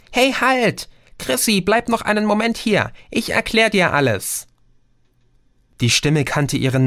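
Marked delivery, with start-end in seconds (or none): 0.1-4.5 high, urgent call to stop; a small high voice calling her back; Lautsprecherstimme. A narrator's reading. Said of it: "ertönte die Stimme nochmals". (hohe Stimme, die Chrissy eindringlich zurückruft)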